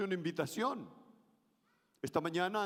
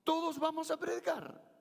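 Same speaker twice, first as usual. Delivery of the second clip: 1 high forceful call; 8 semitones higher than usual. Shown as a demonstration. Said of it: "Si yo le entonara de ese modo".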